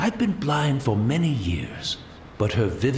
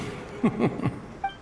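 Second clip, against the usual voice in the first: a quiet breathy laugh; soft airy chuckle; 10 semitones higher, 4 dB quieter than usual. Acted. Old man chuckling about the Shogun fetching tea. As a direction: chuckle at the irony. Laughs at his own irony.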